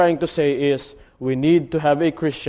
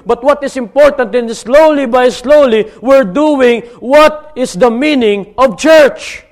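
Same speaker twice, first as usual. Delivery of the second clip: fervent, raised sermon appeal; rally them to action; preaching at full force; 8 semitones higher, 8 dB louder than usual. Acted. Preacher urges his church to stop sitting, fervent.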